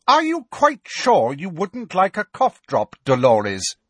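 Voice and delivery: pointed offer. A narrator's reading. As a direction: ask slowly, barbed. McGonagall, pointed repeat.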